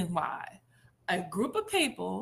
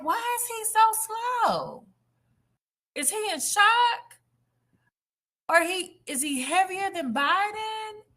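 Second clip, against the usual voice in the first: mocking disbelief; 9 semitones higher than usual. Mocking Trump's slowness.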